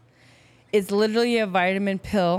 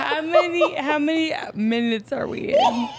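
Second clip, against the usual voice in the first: laughing hard, louder and higher; 8 semitones higher, 4 dB louder than usual. Real, not acted. Drunk laughing fit.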